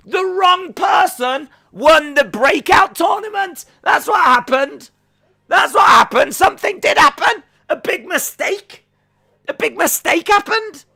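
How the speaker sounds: high, loud, drawn-out complaint; indignant outburst. Real, not acted. Fan decrying the wrong winner, indignant high shout. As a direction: shout it high in exaggerated outrage. Mock outrage at tournament result.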